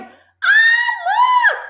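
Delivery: put-on very high, frantic boy's shriek; hysterical shrill scream. A narrator's reading. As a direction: shriek it in terror. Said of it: "hysterical and shrill was Nigel's scream".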